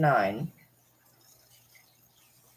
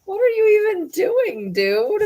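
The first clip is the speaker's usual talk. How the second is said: what on earth are you doing, pitched way up; exasperated scolding. Real, not acted. Scolding the cat.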